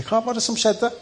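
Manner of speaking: the lion wonders aloud, playfully; curious lion. Acted. Lion approaches the fallen man. (nysgjerrig løve, lysere undrende stemme)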